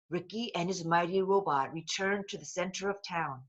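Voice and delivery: ordinary delivery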